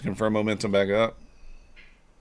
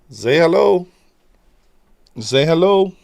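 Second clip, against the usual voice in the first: pet talk; 8 semitones higher, 9 dB louder than usual. Real, not acted. Asking the cat to greet.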